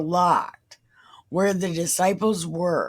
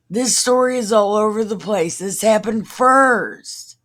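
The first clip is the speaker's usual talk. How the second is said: louder, exasperated and a bit higher; exasperated outburst; 4 semitones higher, 6 dB louder than usual. Real, not acted. Reader fed up with the confusing Bible passage, exasperated outburst. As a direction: throw up your hands at a story that jumps around.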